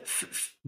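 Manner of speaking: ف- a voiceless f hiss, teeth on lip; f sound drill. Shown as a demonstration. Teacher drilling the f sound for students. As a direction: blow out ف- as a soft f. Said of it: "belief بالإف".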